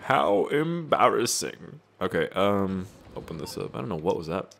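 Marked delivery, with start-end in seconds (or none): 0.0-1.8 high, drawn-out creature quote; slow rising croon, pitched up; Yoda. Acted. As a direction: put on a high, slow character voice. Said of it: "That was from Yoda".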